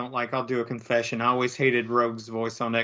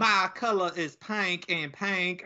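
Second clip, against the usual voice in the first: put-on slow, overdone Southern accent, a little higher; mocking Julia Roberts' Southern accent; 6 semitones higher, slower than usual. Mocking an actress's bad Southern accent, slow and overdone. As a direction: lay the bad Southern accent on thick, slowly. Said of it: "her Southern accent is horrible".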